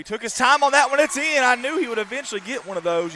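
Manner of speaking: rising play-by-play call; excited. Real, not acted. Excited call of a made three.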